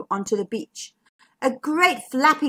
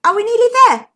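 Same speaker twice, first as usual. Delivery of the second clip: eager; 11 semitones higher, 9 dB louder, much faster than usual. Acted. Zigbee asking impatiently.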